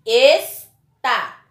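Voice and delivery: "Está" as Es... tá, loud with a gap; slow, detached "Está" for pupils; split into syllables. Shown as a demonstration. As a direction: break "Está" firmly into two syllables. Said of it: "Então, separe".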